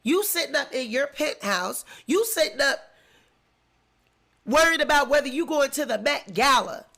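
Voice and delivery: ordinary talk, nothing marked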